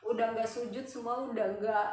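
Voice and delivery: usual speaking voice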